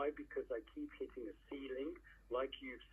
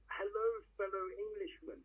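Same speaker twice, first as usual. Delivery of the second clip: cheery call out; 7 semitones higher than usual. Retold or imitated. Calling out to strangers in hotel.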